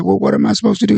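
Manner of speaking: high-pitched panicky question; nervous young comic. Retold or imitated. Young comic asking his friend, high and panicky. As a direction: squeak it out, lost and scared. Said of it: "I said".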